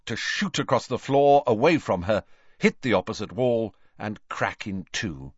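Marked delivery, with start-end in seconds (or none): none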